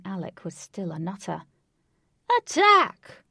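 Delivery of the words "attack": very high, loud battle cry; ordering from afar. A narrator's reading. Villain sending his mannequin army in, shouted order. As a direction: yell the command across the field. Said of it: "ordered Lutz from afar".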